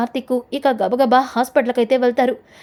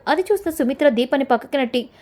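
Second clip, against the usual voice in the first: ordinary delivery, nothing marked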